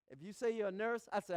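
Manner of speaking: ordinary talk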